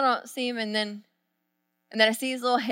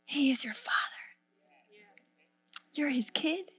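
softer, whispered reassurance; a hushed, intimate he is your father; whispered assurance; 3 semitones higher, 11 dB quieter than usual. Real, not acted. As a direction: whisper it tenderly to the hurting listener.

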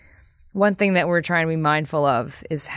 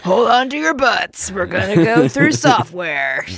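hammed-up epic line; 2 semitones higher, 6 dB louder than usual. Mock epic presentation line.

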